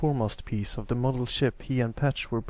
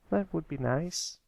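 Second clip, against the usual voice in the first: put-on wistful young colt; 7 semitones higher than usual. Little brother wistfully replying to his big brother. Pipsqueak voiced.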